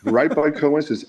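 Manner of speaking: normal talking voice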